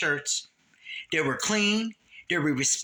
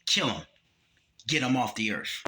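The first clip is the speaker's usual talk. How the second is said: voice of God; 7 semitones lower than usual. Retold or imitated. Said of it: "the Most High saying".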